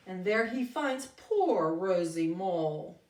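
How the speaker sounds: ordinary talk, nothing marked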